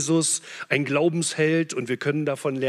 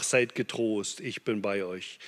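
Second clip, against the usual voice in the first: gentle comfort; 7 semitones lower, 8 dB quieter than usual. A narrator's reading. Jesus comforts the disciples.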